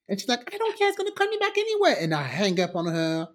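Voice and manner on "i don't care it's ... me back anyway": high, hurried mocking voice; mock-snippy imitation; mimicking a dismissive rival. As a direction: brush it off in a put-on high pitch. Mimics rival woman on phone.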